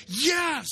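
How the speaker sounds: Yes punched hard with full conviction; emphatic yes. Real, not acted.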